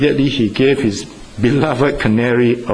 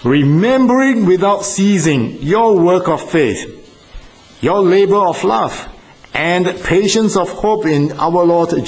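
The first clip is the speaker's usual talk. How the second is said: raised, measured Bible reading; scripture reading; 4 semitones higher than usual. Acted. Preacher opening the sermon with scripture, solemn reading. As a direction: read the verse out with solemn weight.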